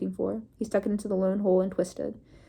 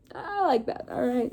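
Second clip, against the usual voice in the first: amused and delighted; 5 semitones higher, slower than usual. Real, not acted. Reader's aside, amused.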